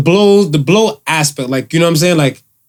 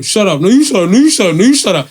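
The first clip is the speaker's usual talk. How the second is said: mock sibling squabble; 4 semitones higher than usual. Kids bickering acted out.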